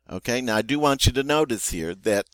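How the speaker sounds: ordinary talk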